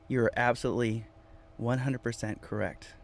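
normal talking voice

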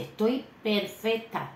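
smug bragging quote; mock smug boast. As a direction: play the show-off sister, lightly hammed. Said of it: "Ella dice".

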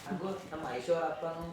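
soft conspiratorial murmur; hushed talk. Acted. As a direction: keep it down so the daughter won't hear. Parents talk secretly about match.

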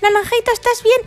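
very high, fast mock fright; high frantic dinosaur fear; mock panic. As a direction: squeal in hammed-up terror.

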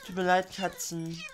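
a loud, hammed-up sorry to the cats; mock apology. Apologizing to the cats he is killing, hammed-up and loud. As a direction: exaggerate the fake remorse.

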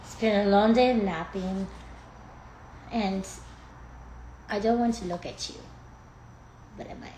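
soft, sleepy put-on pet voice; hushed, halting talk as the dog; speaking for dog. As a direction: voice the reluctant dog quietly.

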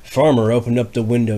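usual speaking voice

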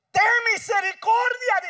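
loud, high cry for mercy; desperate plea. Acted. Woman crying out to Jesus, loud high plea. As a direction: beg at the top of the voice. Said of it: "dice ella".

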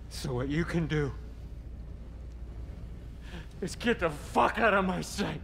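rising into a furious shout. Acted. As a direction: spit it out in anger. Furious dismissal.

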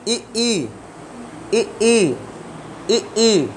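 ई, ई said with the tongue front pressed back; vowel demo. Shown as a demonstration. Teacher showing students how to say ई. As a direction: hold the tongue and sound ई, ई clearly. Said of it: "आगे वाला हिस्सा पीछे को pressure कर रहा है".

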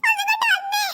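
high-pitched, tearful panic; frantic mother. Acted. Mother searching for her missing child, panicked. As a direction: call out desperately, near tears.

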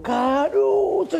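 very drawn-out, high call; long high holler, held on the vowel; ecstatic fan cry. Retold or imitated. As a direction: imitate a fan wailing his idol's name in joy. Fan whirls him round, calling out.

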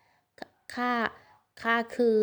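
stumbling over the first word, flustered; start, stop, start again like she's been caught out; flustered stammer. Acted. Caught off guard by boy's question.

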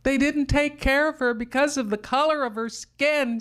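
put-on high child's voice protesting; a girl pushing back at her aunt; upset child. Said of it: "I said".

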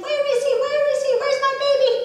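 high, loud, worried mother calling; a frantic mum calling out, pitched high. Acted. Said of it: "very worried and saying".

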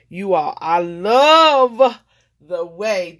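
slow, swelling gush of delight; overjoyed. Real, not acted. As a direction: pour out real delight, slowly and loudly. Overjoyed at finished card.

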